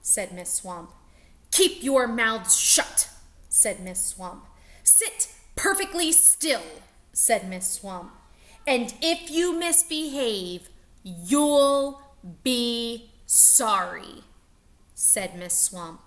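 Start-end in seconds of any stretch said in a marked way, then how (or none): 1.5-3.1 loud, slow, stern command; stern order. Acted. Miss Swamp commanding class. 4.8-6.8 higher, clipped command; strict teacher demanding stillness; stern Miss Swamp. Acted. 8.7-14.2 loud, very slow menacing threat; witchy threat. Acted. Miss Swamp threatening class.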